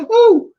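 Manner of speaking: a high, loud delighted ooh; excited ooh. Real, not acted. Thrilled by a same-sex spouse shout-out, high delighted ooh. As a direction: squeal with glee at the mention.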